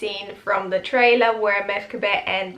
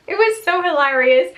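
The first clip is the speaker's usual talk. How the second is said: high, louder laugh through the words; 8 semitones higher, 5 dB louder than usual. Real, not acted. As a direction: crack up while saying it. Laughing at funny scene.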